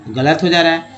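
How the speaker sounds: ordinary talk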